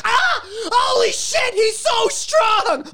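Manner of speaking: very high, slow panicked shout; panicked shriek. Pretend. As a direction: shriek in pain.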